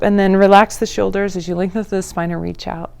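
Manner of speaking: normal talking voice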